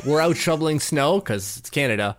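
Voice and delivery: normal talking voice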